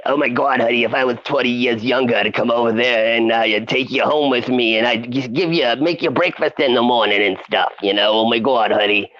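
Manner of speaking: put-on older Chicago barfly voice; Cookie's Chicago accent. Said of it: "she'd be like".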